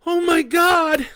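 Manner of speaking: high, loud, terrified outcry; cry out. Acted. Leah sees the launch, a high loud terrified cry. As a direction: scream it in horror. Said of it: "Leah cries out".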